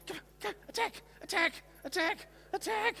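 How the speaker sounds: very high, playful "attack"; high-pitched urging. Real, not acted. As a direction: egg the dog on, squeaky. Urging dog at the other.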